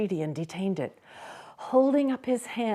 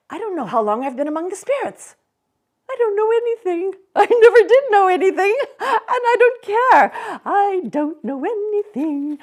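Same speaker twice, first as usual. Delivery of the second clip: fast, loud, much higher giddy rant; carefree Scrooge; 13 semitones higher, 8 dB louder, faster than usual. Acted. Scrooge not caring what day it is, giddy. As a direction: toss it off with reckless joy.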